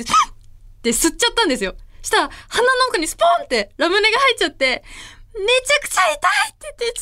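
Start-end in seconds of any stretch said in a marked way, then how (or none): 5.4-6.1 imitated crying complaint. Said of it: "すごい泣き出した".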